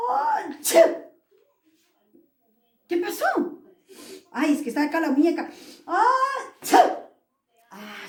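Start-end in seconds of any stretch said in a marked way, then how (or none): 0.1-0.8 loud, high mock sneeze; fake sneeze. Shown as a demonstration. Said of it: "Imagínate si yo digo". 5.9-6.8 high, loud put-on sneeze; fake sneeze. Teacher fakes doll sneeze.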